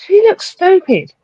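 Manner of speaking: exasperated high scoff. Real, not acted. Exasperated judgment.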